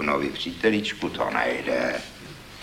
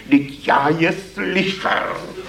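slow, higher, defensive protest with a laugh; 8 semitones higher, 3 dB louder, slower than usual. Acted. Young poet defending himself to the critic, slow and higher. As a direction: protest that you really heard them. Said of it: "Bránil se hlas".

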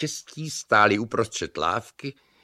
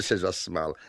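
plain reading voice

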